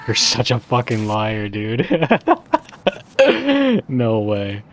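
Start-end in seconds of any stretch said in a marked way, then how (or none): 1.9-3.8 loud high burst of laughter; disbelieving laugh. Real, not acted. Player calling the game a liar, loud laughing. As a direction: crack up loudly, high and falling.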